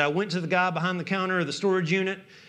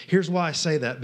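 ordinary talk

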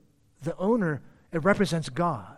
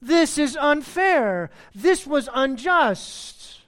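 slow indignant protest; 6 semitones higher, 5 dB louder, much slower than usual. Retold or imitated. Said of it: "Their comment is this".

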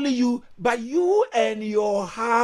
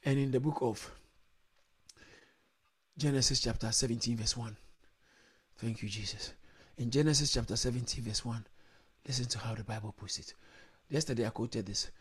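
soft aside; 14 dB quieter, faster than usual. Real, not acted. Quiet scripture reference, far off.

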